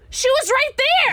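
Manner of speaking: exclaiming. Real, not acted. Player protests at the game.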